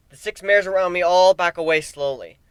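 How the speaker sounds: usual speaking voice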